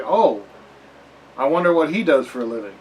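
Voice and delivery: playful sarcastic musing; mock wondering. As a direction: feign curiosity about an obvious costume, loudly. Mock onlooker mocking goofy assassin costume.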